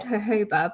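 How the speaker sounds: "jojoba" with H in place of each J; jojoba with H sounds. Shown as a demonstration. Host defending her habit, "jojoba" said ho-HO-ba. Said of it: "I've always said jojoba".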